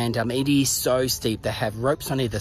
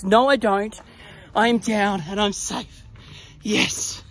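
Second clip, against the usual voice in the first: relieved; 7 semitones higher, slower than usual. Real, not acted. Safely down, relieved.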